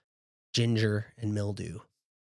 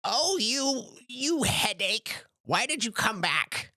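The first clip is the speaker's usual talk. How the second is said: put-on a much higher old granny, fondly scolding; 11 semitones higher than usual. Granny scolding her grandson Jinx, high and fond. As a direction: play the fussing giant grandma, pitched way up. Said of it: "and she's like".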